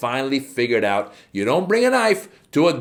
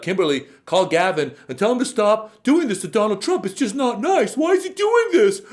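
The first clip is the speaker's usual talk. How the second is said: whiny mock plea, pitched up and building to a crying shout; 8 semitones higher than usual. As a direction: whimper it like a tearful tattletale. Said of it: "so maybe she can call Kimberly".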